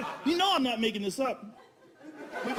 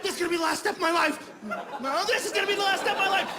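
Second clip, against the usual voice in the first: panicked inner voice; 7 semitones higher, faster than usual. Acted. Intruder's panicked thought, acted out.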